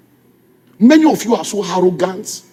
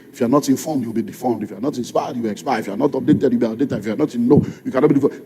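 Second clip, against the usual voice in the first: recited slogan; 8 semitones lower, 7 dB quieter, faster than usual. Acted.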